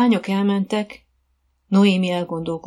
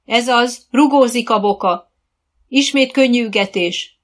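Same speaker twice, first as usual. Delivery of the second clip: loud, higher, cheering coach; called-out encouragement; 5 semitones higher, 7 dB louder than usual. A narrator's reading. Coach to riders mid-trot, loud encouraging call. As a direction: praise and direct them from across the arena.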